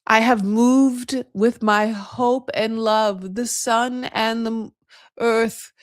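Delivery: slow, grand mock declaration; expressive Arabic parent. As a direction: imitate an Arabic parent pouring out a solemn blessing. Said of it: "but, like, expressive".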